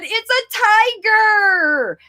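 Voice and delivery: high, slow, delighted; high excited reveal to the child. Shown as a demonstration. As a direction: announce the tiger with wonder. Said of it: "I said".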